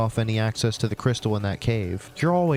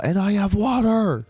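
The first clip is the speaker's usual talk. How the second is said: triumphant; 7 semitones higher, 3 dB louder than usual. Acted. Triumphant at dinner.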